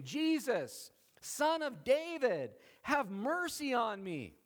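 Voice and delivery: high, slow, drawn-out plea; crying out. Acted. Blind beggar calling to Jesus for mercy, crying out. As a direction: call out for mercy over a crowd. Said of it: "And he cried out".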